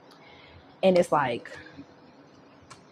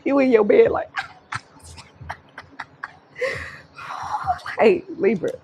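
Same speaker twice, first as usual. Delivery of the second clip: laughing; 6 semitones higher, 4 dB louder than usual. Real, not acted. Laughing mid-impression.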